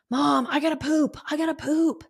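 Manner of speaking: put-on high, hushed kid's plea; urgent small-boy voice; whiny little boy. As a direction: play the desperate little son. Son's urgent plea retold.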